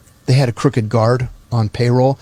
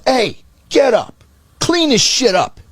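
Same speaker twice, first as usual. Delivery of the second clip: high-pitched, mock-angry boss order; imitating a bossy wake-up call; barked order; 10 semitones higher than usual. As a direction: bark it like a boss rousting an underling. Boss orders man awake.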